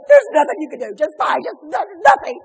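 brushing him off in tears; upset wife. Retold or imitated. Crying wife snaps at her far-away husband, loud and high. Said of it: "And I was like".

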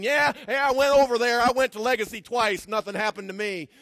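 loud, dismissive mimicry of a quitter; mock excuse. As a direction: parody the lazy excuse, hammed up.

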